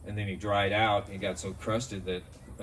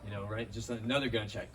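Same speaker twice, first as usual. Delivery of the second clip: usual speaking voice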